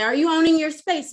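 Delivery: mock boss checking in; quizzing boss. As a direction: call out a routine check-up, lightly acted. Boss quizzes her loudly.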